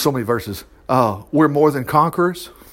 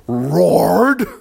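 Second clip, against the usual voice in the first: long drawn-out growled roar; acted roar. Preacher showing how the king responded, drawn-out roar. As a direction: growl the word out like the roar itself.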